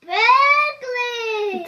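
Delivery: high, loud, drawn-out shout; yelling. Real, not acted. Player answering the whispered question, yelling the name. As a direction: holler the name, stretched long.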